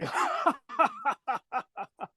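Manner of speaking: long, high, soft mock cackle; imitated boss laugh. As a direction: laugh on and on at your own joke, pitched high. Imitating the boss amused by himself.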